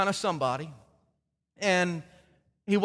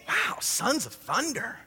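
mock awe; 3 semitones higher, 4 dB quieter, slower than usual. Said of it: "And you think".

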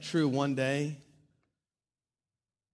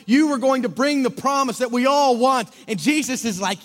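raised, ringing preacher's voice; preaching at full force; 8 semitones higher, 8 dB louder than usual. Acted. Pastor preaching to his congregation, full force. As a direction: preach it out big to the whole room.

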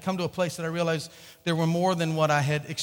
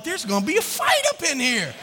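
high, loud comic alarm; excited exclamation; 7 semitones higher, 4 dB louder than usual. Retold or imitated. Teen watching two women yell in church, gleefully excited. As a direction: call it out like a thrilled spectator.

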